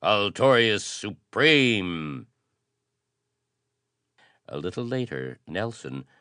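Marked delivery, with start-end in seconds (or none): 0.0-2.2 loud, slow battle cry; ringing cry. A narrator's reading. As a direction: shout it like a rallying war cry. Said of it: "ringing cry".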